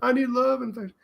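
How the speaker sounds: jokey high needy voice; mock needy plea. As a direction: plead for affection in a high little voice. Mock voice of the love-starved character.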